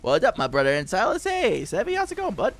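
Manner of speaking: friendly greeting climbing very high; chummy clown, going up and up; Tim Curry Pennywise. Retold or imitated. Greeting chat as Tim Curry's clown, rising high. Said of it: "for the Tim Curry Pennywise".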